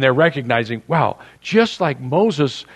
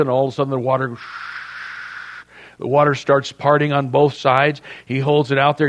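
ordinary talk, nothing marked

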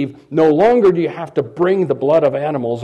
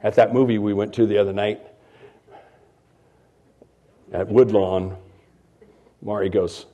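normal talking voice

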